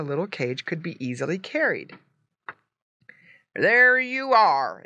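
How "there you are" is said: drawn-out, louder, higher exclamation; exclaiming. A narrator's reading. Farmer finishing with the box, exclaiming. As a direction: announce it loudly, stretching the words. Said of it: "exclaimed the farmer".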